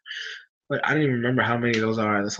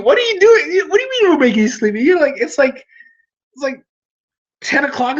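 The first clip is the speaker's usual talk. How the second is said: high, laughing disbelief; incredulous; 12 semitones higher, 5 dB louder than usual. Real, not acted. Streamer to viewer Natasha, laughing high disbelief. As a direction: squeak out mock outrage at the viewer.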